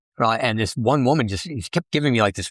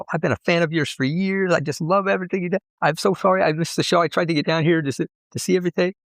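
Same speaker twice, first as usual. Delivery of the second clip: higher, eager gush of a fan; mock a breathless admirer pouring it out; woman at the bar raving to the performer; 7 semitones higher than usual. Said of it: "She's like".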